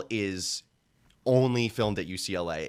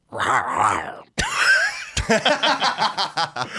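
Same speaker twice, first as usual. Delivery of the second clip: loud burst of laughter; big laugh; 6 semitones higher, 4 dB louder than usual. Real, not acted. Laughing after audition take.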